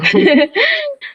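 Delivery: short, very high laugh that falls away. Real, not acted. Mother laughing as she finishes the school story, high laugh. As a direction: laugh it off at the end.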